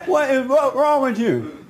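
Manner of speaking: loud, high retold outburst; exasperated shout. Teller to the woman in his face, exasperated shout. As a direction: raise the voice in indignant protest.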